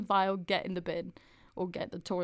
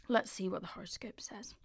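whispered aside; 7 dB quieter than usual. Real, not acted. Aside before reading horoscope.

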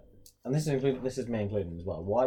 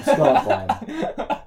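laughing; 5 semitones higher, 9 dB louder, much slower than usual. Real, not acted. Joking about Benteke's goal.

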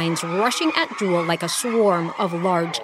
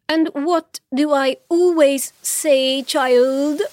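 slow, higher-pitched motherly prompting; lecturing; 7 semitones higher, 4 dB louder, slower than usual. A narrator's reading. Mother testing her daughter on her saying, lecturing. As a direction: quiz the child imperiously, rising at the end. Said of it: "continued lecturing".

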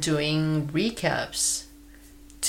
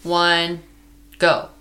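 loud, high countdown call; 4 semitones higher, 7 dB louder, slower than usual. Real, not acted. Host counting down to going on air, loud and high. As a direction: count them in, rising to the go.

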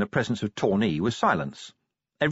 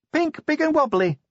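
much higher, bright announcement; Cheery announcing; 3 dB louder than usual. A narrator's reading. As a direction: read it out proudly. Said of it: "she announced".

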